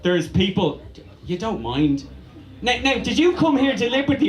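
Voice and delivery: usual speaking voice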